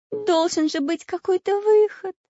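put-on high, loud girlish wail; very high, plaintive child; tearful. A narrator's reading. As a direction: plead tearfully in a little girl's voice. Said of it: "заплакала Настенька".

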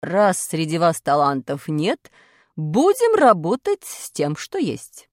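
stern teacher quizzing class; strict teacher. Acted. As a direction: demand to know who has talent. Teacher voice in story.

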